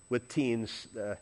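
usual speaking voice

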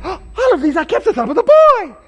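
high, fast, happy claim; excited boast. Retold or imitated. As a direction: voice the man thrilled with himself. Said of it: "the guy's excited".

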